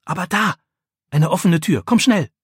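hoch und hastig, etwas lauter; plötzlich einen Ausweg entdecken; aufgeregt. Acted. Jakob entdeckt Ausweg, ruft Freund. (aufgeregter, hoher Ruf)

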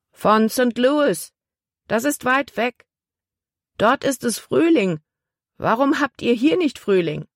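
put-on a puzzled little girl wondering about spring; curious little girl. A narrator's reading. Girl on the shore puzzled by the cold, high and curious. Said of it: "rief ein Mädchen".

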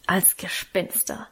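slow, soft, hushed ghostly tone; spooky ghost voice. Acted. Karl whispers the plan to the children, slow and spooky. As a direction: drag it out like a ghost.

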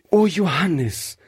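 eager call. Acted. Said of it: "rief Rebekka".

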